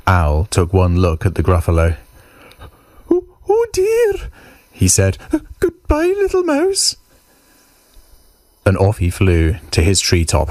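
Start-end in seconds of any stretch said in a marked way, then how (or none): 3.1-4.3 very high, startled owl voice; alarmed owl. Acted. Owl takes one look at the Gruffalo, high alarmed voice. 5.3-7.0 very high, flustered goodbye; frightened owl excusing itself; nervous owl farewell. Acted. Owl flees from the Gruffalo, high nervous voice.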